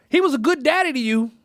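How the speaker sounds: a hint of an old woman consoling her grandson; grandmother's voice.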